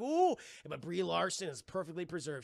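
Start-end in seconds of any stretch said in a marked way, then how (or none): 0.0-0.4 high ooh. Retold or imitated. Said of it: "And Khan's like".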